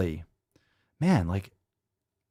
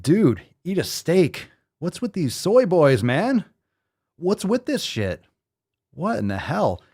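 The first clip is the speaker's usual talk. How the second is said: exasperated rant; 6 semitones higher, 3 dB louder, slower than usual. Acted. Mocking reaction to vegan video.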